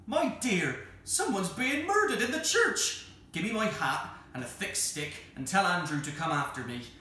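high, loud cry of alarm. Acted. Vicar tells his wife of a murder in church, alarmed. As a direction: raise the alarm urgently, peaking high.

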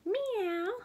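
high mock meow; cat meow. A narrator's reading. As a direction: play a little cat. Cat sound in story.

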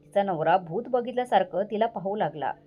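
plain reading voice

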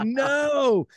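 high drawn-out "No." of dismay; mock despairing no. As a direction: wail a stretched-out no in mock despair. Retold despair cry.

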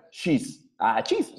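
ordinary delivery

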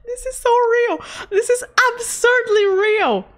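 high-pitched, amused exclamation; emphatic agreement. Real, not acted. Streamer agreeing with chat about her face, emphatic agreement. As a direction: squeal it in delighted agreement.

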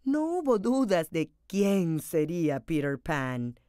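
plain reading voice

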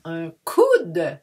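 "un coude" said slowly, stretching "coude"; slow, careful "un coude"; slow model. Shown as a demonstration. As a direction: draw out "coude" so it isn't "cou". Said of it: "not to mistake with le cou".

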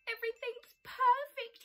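high, loud joy; delighted. Acted. Bear delighted after the tumble. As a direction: burst out happily.

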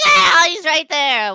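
loud, high mock-scared cry; panicked yelp. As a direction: yelp a sudden alarm, drawn out. Sudden sight of demon baby.

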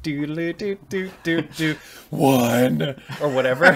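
high jingle sliding into drag queen. Pretend. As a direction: sing the jingle, sassy and camp. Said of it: "started up really high and then it went into this drag queen voice".

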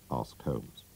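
usual speaking voice

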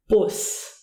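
"Bus" with a brief, short U. Shown as a demonstration. Teacher contrasting long and short U, short vowel. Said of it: "We use the short form".

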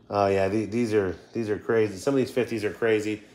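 ordinary talk

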